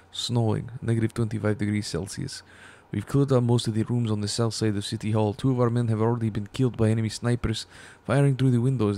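loud put-on Russian accent. Soviet private's journal read out on stream, Russian accent. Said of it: "Ignore the stupid Russian accent".

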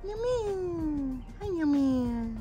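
high singsong call to a pet. Real, not acted. Calling out to her pet.

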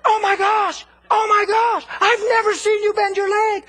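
high, loud, amazed shouting; freaking out. Retold or imitated. Neighbor sees the woman bend her stiff leg, shouting amazed. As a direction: shout in astonished disbelief. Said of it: "her friend's freaking out".

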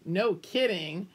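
plain reading voice

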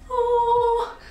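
high sung "oh" of delight; delighted gasp. Retold or imitated. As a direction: sing out a high, thrilled oh. Spots planter in the window.

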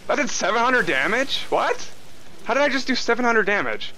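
high-pitched disbelief; incredulous at a lucky hit; astonished. Real, not acted. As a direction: question it with real surprise, pitch jumping up. Startled by unexpectedly high damage.

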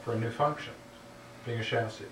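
normal talking voice

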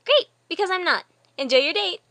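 loud, high, quick-witted send-off; spring the trap loudly and brightly; high, gleeful announcement; triumphant set-up. Acted.

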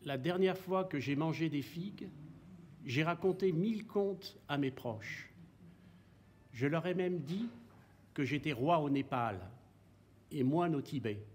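measured, softened poetry reading with long pauses; poem recitation. Acted. Poet reciting verses about light and figs, measured poem recitation. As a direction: recite line by line, pausing after each image.

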